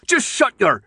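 high, loud yell, cut short. Acted. Newt yells at the captor until silenced.

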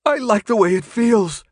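high, loud desperate whine; pleading. A narrator's reading. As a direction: plead your case in a wail. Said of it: "The pleading in the man's voice".

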